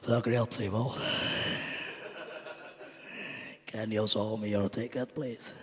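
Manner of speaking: lightly voiced turtle of a conductor. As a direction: imitate a plodding turtle, gently mocking. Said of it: "he sounds like a turtle".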